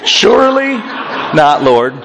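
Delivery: SURELY pitched very high, then a firm drop on "not, Lord"; hammed-up emphatic protest; emphatic refusal. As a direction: repeat the objection in a high, emphatic voice. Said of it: "Peter says emphatically no".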